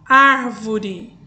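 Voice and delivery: "árvore" with a loud, stressed "ár"; teacher's demo of "árvore", "ár" strongest; stress on first syllable. Shown as a demonstration. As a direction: punch the first syllable of "árvore", then let it fall. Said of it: "antepenúltima sílaba".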